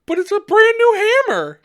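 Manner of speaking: high, loud plaintive protest; mock protest. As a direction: plead loudly for a prized possession. Retold protest to the cop.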